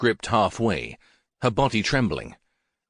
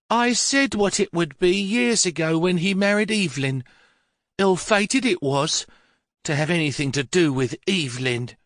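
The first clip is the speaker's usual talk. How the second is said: put-on bitter old mother; 9 semitones higher than usual. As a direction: mutter an old grudge, high and sour. Mother blames the son's wife.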